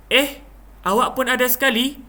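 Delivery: high, quick, surprised question; high-pitched astonishment; puzzled surprise. Shown as a demonstration. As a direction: say it as if baffled to find them there. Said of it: "intonasi dia begitu".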